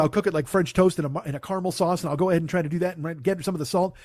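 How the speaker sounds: fast, panicked babble; panicked rush. Retold or imitated. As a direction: gabble it out in a panic. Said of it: "she panics and she's like".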